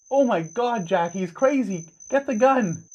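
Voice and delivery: high, soft voice of a frightened woman; panicked woman. Acted. Frightened woman calls for the gun.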